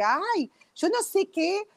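high, very slow, unsure drawl; hesitant dismissal. Retold or imitated. Telling a friend she's not sure about the detox, high slow hesitation. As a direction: stretch it out like you can't be bothered. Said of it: "yo le dije".

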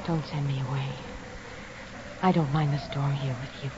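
soft seductive. Acted. Evelyn softly seduces Douglas alone.

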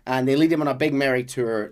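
ordinary talk, nothing marked

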